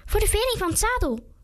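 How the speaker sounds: mocking high-pitched echo; squeaky-high mocking imitation; high-voiced mimicry. A narrator's reading. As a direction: parrot him back in a high voice. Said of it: "deed hem na met een hoge stem".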